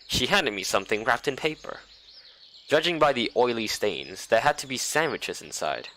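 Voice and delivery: ordinary delivery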